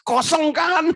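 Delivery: mocking squeak. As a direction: toss it up high like a cheeky demand. Mocking the demanding prayer.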